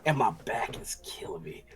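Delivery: a whispered tired complaint; whispering. Real, not acted. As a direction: breathe it out as a whisper. Tired aside about back pain.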